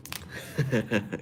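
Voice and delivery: short chuckle at himself; real, soft-edged chuckle; amused laugh. Real, not acted. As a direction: laugh off a silly impression. Amused after own bad impression.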